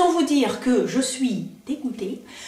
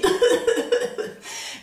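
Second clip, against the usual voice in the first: breathy laugh; 10 semitones higher than usual. Real, not acted. Laughs at volatile powder.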